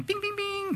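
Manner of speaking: high, pinging imitation of a dashboard chime; ping it out like a car warning light; warning chime bings. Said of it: "comes with those annoying little sounds".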